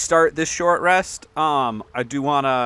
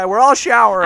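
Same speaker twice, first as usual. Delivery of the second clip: cheerful call; 5 semitones higher, 7 dB louder than usual. Acted. Calling the group into the shower.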